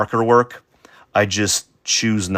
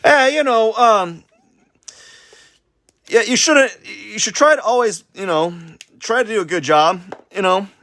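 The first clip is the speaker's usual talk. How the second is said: much higher, louder put-on voice giving advice; mock-wise little character voice, a bit louder; silly advice voice; 12 semitones higher, 3 dB louder than usual. Artist lecturing viewers in a high cartoon voice, playful.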